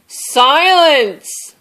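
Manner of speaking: loud, high angry roar. A narrator's reading. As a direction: bellow it to end the noise. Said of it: "Bear roared".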